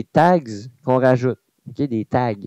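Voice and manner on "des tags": ordinary talk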